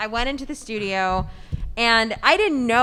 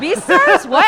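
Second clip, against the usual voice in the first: high, loud, shocked question; shocked disbelief; 9 semitones higher, 11 dB louder than usual. Retold or imitated. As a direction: cry out the name in disbelief. Shocked by the show name.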